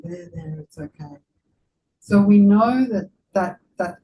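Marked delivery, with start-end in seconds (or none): 0.0-1.2 much softer, gentle there-there; soothing comfort. Retold or imitated. Friend comforting someone who is suffering, soft and soothing. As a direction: hush a suffering friend kindly. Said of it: "or just went".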